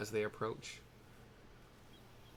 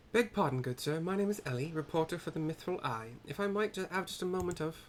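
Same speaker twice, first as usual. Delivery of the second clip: higher-pitched, courteous reporter voice; polite reporter character; 7 semitones higher than usual. Acted. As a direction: politely ask for a moment in a raised voice.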